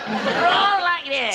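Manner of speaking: high, slow, strangulated Sandhurst accent; extraordinary upper-class accent. Shown as a demonstration. Old man parodying a fellow he knew, extraordinary Sandhurst accent. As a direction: stretch a caricatured officer accent up high. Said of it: "with a most extraordinary accent".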